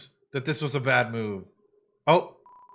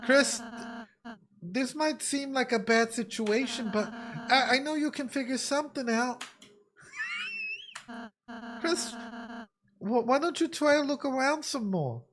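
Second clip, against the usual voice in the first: put-on Ralsei accent; 8 semitones higher than usual. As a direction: encourage gently in a high, put-on accent. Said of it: "I love the accent in Ralsei".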